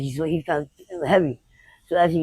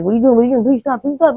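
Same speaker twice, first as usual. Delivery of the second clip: high, louder begging, re-enacted; retold desperate pleading; 7 semitones higher, 7 dB louder than usual. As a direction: act out pleading with an attacker to stop. Retells pleading while being hit.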